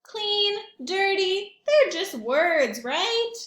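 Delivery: high, loud, wheedling pigeon; stubborn pigeon. Acted. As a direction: argue it like a cheeky bird. Pigeon argues his case.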